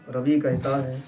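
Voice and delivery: ordinary delivery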